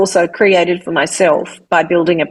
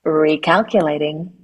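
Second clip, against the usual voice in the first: a calm, evenly read sat-nav prompt; GPS voice demo; 4 semitones lower, 4 dB quieter than usual. Shown as a demonstration. Voice artist recreating the famous sat-nav line. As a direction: say it like a patient navigation voice. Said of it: "And everybody's favorite".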